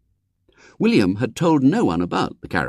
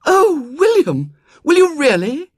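high, slow, delighted gasp; a girl thrilled by his offer; overjoyed girl; 7 dB louder than usual. A narrator's reading. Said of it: "Her face beamed with joy".

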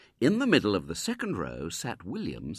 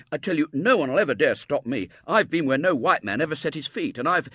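loud, higher schoolboy voice; boastful boy William; 9 semitones higher, 9 dB louder than usual. A narrator's reading. Boy bragging to a little girl, loud boastful voice. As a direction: brag with loud, punchy confidence.

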